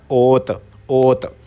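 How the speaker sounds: ओत said slowly with a clear round o; slow Korean demo. Shown as a demonstration. Teacher models the Korean word for students, slow and careful. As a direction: stretch out ओत, rounded o, not oo. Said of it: "तो ये क्या बन जाएगा?".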